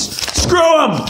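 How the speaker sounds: exasperated outburst. Real, not acted. Rants about hating the kid.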